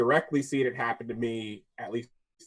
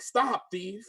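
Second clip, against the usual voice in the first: higher, shrill-free imitation of a lady calling out; mock accusing lady; 7 semitones higher than usual. Store lady shouting at the worker, imitated high. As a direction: play the accuser chasing him, pitched up. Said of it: "She's like".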